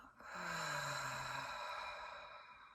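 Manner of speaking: long open-mouthed exhale, tapering away; long sigh out. Shown as a demonstration. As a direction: breathe all the way out in one big sigh. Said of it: "Open your mouth and sigh out".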